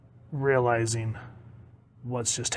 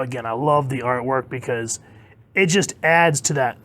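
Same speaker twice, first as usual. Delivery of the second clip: ordinary talk, nothing marked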